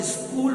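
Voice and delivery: extra "i" at the start of स्कूल; wrong pronunciation. Shown as a demonstration. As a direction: mispronounce स्कूल as "iskool". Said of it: "wrong हो जाएगा". (स्कूल के आगे "इ" जोड़कर बोलें)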